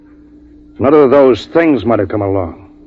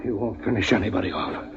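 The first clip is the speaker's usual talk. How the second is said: much softer, cold threat; quiet menace; 13 dB quieter than usual. Acted. Drawing a gun.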